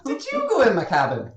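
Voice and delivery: put-on Irish accent. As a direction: demand it in the captain's Irish voice. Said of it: "this is a good accent".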